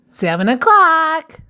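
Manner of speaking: high, bright-pitched call of the hour; lifted, announced clock time; time announcement. Acted. Calls out story time.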